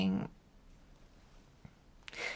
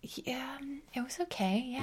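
whispering; 6 semitones higher, 8 dB quieter than usual. Acted. Intimate phone call, whispering.

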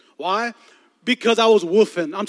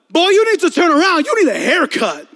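higher, louder mocking call across the room; real loud voice; 9 semitones higher, 9 dB louder than usual. Girl behind him roasting his hair, loud. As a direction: call him out so eight rows hear. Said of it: "says in a real loud voice".

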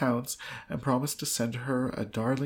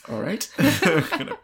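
laughing loudly through the joke; can barely get the words out for laughing; 2 semitones higher, 9 dB louder than usual. Real, not acted. Reader cracking up at the silly wedding gift, laughing loudly.

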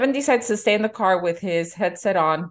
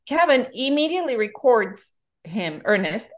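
ordinary talk, nothing marked

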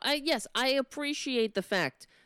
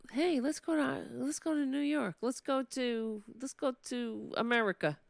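higher put-on voice imitating grandparents; exaggerated grandparent impression, higher than your own; mock grandparents; 4 semitones higher, 3 dB quieter than usual. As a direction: play eager emigrating grandparents, pitched up. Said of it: "they didn't say it like that".

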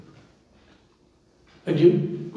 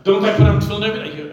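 protesting worshipper; 7 semitones higher, 7 dB louder, faster than usual. Retold or imitated. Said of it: "then you say to Hashem".

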